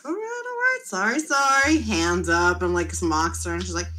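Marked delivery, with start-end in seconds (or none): 0.0-1.8 mock surrender. Mock surrender after startling the kid.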